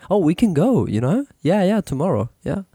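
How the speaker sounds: high, eager agreement; the person in the bar gushing yes to the cinema; excited date. Pretend.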